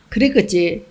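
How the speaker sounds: ordinary delivery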